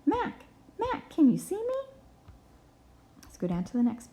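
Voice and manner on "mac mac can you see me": calling out. Acted. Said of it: "and he's saying".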